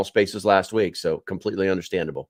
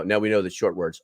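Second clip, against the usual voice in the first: ordinary delivery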